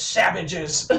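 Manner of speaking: low imitation of a disgusted hero; gruff gunslinger. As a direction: pronounce it like an appalled outsider, low. Imitates gunslinger disgusted by hot dog.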